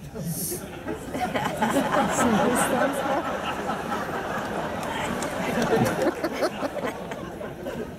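long giggling laugh. Real, not acted.

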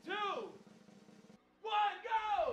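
shouted countdown. Real, not acted. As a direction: call the start like a race starter. Host counts down the challenge.

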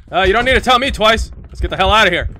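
high, loud get-me-out urgency; loud, rattled eagerness. Real, not acted. Gamer told to run from the house, loud urgency. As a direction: call it out loudly, ready to bolt.

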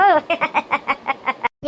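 long stuttering giggle; giddy laugh. Acted. Woman giddy after her love song, long giggle. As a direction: laugh in a run of short bursts.

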